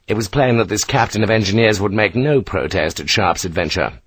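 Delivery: ordinary delivery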